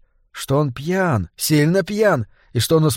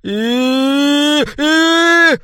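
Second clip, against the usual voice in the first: high, sustained "eee" squeals; two long piercing squeals, the second higher and louder; squealing shriek; 12 semitones higher, 3 dB louder than usual. A narrator's reading. Maître d' shrieks as he swings the knife, squealing. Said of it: "провизжал метрдот".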